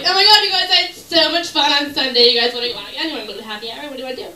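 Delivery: loud, gushing and giddy; bubbly party girl. Retold or imitated. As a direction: gush like the life of the party. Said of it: "I'm like".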